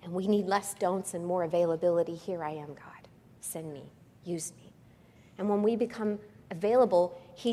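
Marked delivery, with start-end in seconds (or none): 2.1-4.7 soft unvoiced whisper of surrender; whispered prayer. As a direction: breathe the offer to God.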